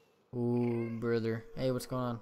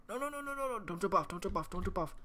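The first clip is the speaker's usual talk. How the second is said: high, rapid-fire, repeated plea; panicked pleading; 7 semitones higher, faster than usual. Real, not acted. Gamer begging it not to jump off, high-pitched panic. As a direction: squeal the warning on high pitch. Urgent plea as something jumps.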